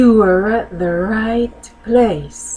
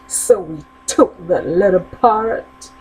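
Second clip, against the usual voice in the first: usual speaking voice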